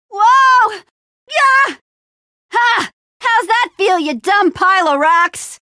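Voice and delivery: high, loud combat cries; triumphant mid-fight shouting; battle taunt. Acted. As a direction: fight and jeer at the enemy. Fighting a stone creature.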